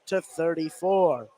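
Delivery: excited call easing back down; play-by-play. Real, not acted. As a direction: ride the comeback, then settle.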